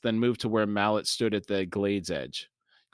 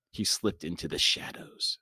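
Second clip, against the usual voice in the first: lower, hushed storyteller's voice; 4 semitones lower, 4 dB quieter than usual. A narrator's reading. Narrator ending the scene in shadows, hushed. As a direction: trail into a near-whisper.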